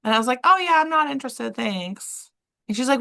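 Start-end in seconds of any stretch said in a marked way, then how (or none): 0.4-2.3 polite brush-off. Retold or imitated. Turning down client, retold.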